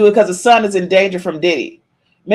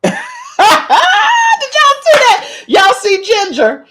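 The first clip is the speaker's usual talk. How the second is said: much higher, pointing it out to everyone; high, excited call of y'all see that; excited squeal. Real, not acted. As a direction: squeal it to the whole chat. Excited reaction to a chat comment.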